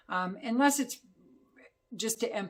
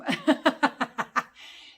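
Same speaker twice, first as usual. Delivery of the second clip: high, loud burst of laughter; gleeful cackle; 6 semitones higher, 8 dB louder than usual. Real, not acted. Reviewer laughing after confessing a love of creepy stuff, gleeful cackle. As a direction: cackle loudly at your own dark taste.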